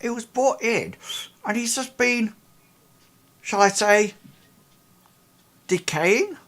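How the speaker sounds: high woman's voice, choosing words with pauses; hesitant Ida. Acted. Ida describing the decaying man to the investigators, a high hesitant woman's voice. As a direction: search for the words delicately. GM holds Ida's voice.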